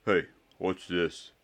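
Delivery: slow dumb lout voice. Acted. Goyle finds the ball.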